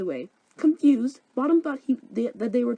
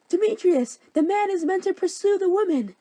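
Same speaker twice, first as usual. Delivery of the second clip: put-on much higher, louder desperate girl voice; high pleading Helena; 11 semitones higher, 5 dB louder than usual. Helena chasing Demetrius, high desperate whine.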